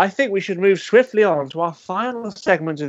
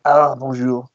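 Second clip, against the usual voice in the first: put-on French accent; 6 semitones lower, slower than usual. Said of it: "my friend, uh, Francois".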